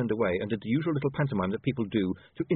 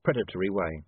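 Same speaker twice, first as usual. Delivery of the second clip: ordinary delivery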